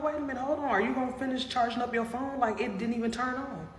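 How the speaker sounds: high, startled questioning of the stranger; surprised protest. Retold or imitated. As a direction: jump up in pitch, baffled he's leaving. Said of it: "I was like".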